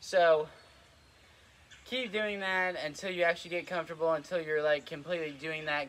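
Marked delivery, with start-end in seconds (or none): none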